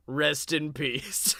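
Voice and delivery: slow, soft low Deadman; Undertaker voice. Retold or imitated. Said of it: "you gotta say that in his voice".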